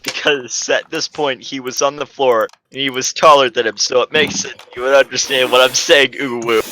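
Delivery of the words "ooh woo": usual speaking voice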